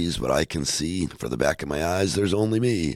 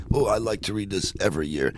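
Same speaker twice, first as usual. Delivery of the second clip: normal talking voice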